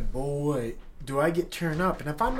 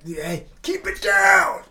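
slow, much higher, loud yell upward; shout; 10 dB louder, much slower than usual. Real, not acted. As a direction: bellow it at the ceiling to shut them up. Yelling at noisy upstairs neighbours.

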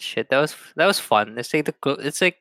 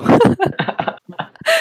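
loud laugh; 5 dB louder than usual. Real, not acted. Laughing at his own haircut story.

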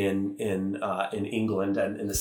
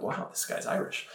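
inner thought; 8 dB quieter than usual. Retold or imitated. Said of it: "I thought".

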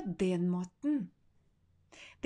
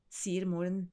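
ordinary delivery, nothing marked

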